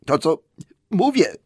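high, breathless reply. Acted. Bilbo, held upside down, answers breathless. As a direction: gasp it out. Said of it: "bez tchu odpowiedział Bilbo".

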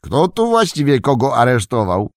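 high questioning shout to passers-by; called-out question. A narrator's reading. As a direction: call it out in amused disbelief. Old man calls to passing prisoners.

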